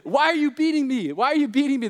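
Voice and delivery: high, loud, aggrieved donkey protest; indignant high-voiced plea; talking donkey. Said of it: "God uses the donkey's voice and says".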